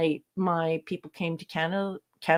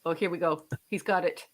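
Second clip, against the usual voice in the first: ordinary delivery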